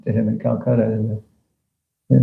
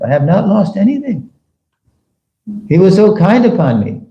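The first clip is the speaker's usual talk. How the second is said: higher and more fervent second reading; fervent repeat; 8 semitones higher than usual. Shown as a demonstration. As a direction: lift it up, moved by the words. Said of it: "Now listen to this".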